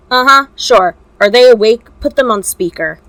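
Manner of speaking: loud, curt brush-off; dismissive. Acted. Shota cutting off the kidnapper, curt and loud. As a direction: wave off the threat and bark orders.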